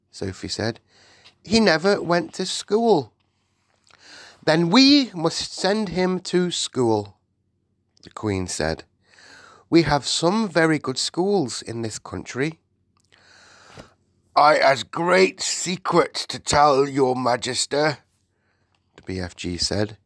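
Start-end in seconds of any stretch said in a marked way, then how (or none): 1.4-3.1 put-on high-pitched little girl's explanation; little Sophie. Sophie explains to the Queen, high little-girl voice. 4.4-12.6 decisive Queen. Acted. Narrator voices the Queen. 14.4-18.0 slow, loud giant voice, pitched up; earnest giant. Acted. BFG brings the Queen secrets, slow and earnest.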